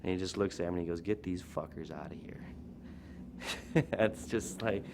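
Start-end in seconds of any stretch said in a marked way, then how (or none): 1.0-2.4 low, understated border guard; quiet deadpan order. Retold or imitated. Border guard to the band's driver after the paint smear, quiet deadpan. 2.5-3.9 quiet, voiceless chuckle; soft laugh. Real, not acted. Storyteller amused by the border guard tale, soft chuckle.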